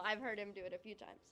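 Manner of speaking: normal talking voice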